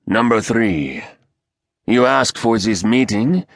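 rasping, menacing council leader; raspy voice. Acted. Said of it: "a raspy voice said".

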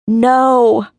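loud high cry of no; anguished shout. Acted. Brother seeing her body, a loud anguished shout. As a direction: cry it out across the mountains. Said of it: "His voice echoed off the silent mountains".

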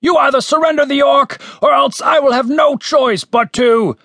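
high, forceful shouted threat; shouted ultimatum. A narrator's reading. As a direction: shout it until cut off. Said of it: "shouted the voice from outside".

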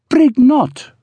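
put-on high, sulky accented woman; sulky complaint. A narrator's reading. Ruby complaining from the bed, suddenly sulky. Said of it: "Ruby complained, suddenly sulky".

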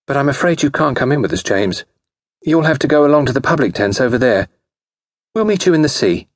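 put-on high, brisk young lady; breezy high-pitched society girl; bright. A narrator's reading. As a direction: dismiss him cheerfully in a high, quick voice. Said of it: "said Grace brightly".

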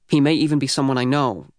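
ordinary delivery

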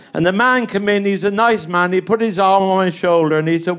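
usual speaking voice

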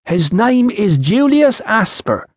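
put-on high, slow, strongly accented Iceni voice; foreign Iceni accent. A narrator's reading. Woman gives the official her husband's name, Iceni accent. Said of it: "trouble following your accent".

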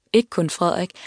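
ordinary delivery

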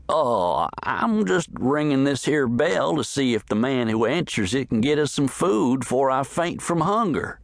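sheepish cowboy caught ringing the bell; Pearlie's voice. A narrator's reading.

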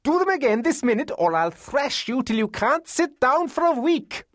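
very high, domineering scolding; threatening cry. A narrator's reading. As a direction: order him about in a high, fierce voice. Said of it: "she cried".

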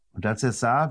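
ordinary delivery

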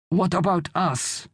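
high, slow, anxious plea; worried wife. A narrator's reading. Wife asking husband about the family, worried. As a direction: draw it out in a high woman's voice.

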